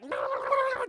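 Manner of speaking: high, loud frantic no's; a squealed string of no's; mock panicked pleading. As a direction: beg in a hammed-up panic.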